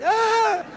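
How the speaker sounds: sudden high yelp of delight; high-pitched comic shriek. Acted. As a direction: let out a squeal at your own joke. Shriek after punchline.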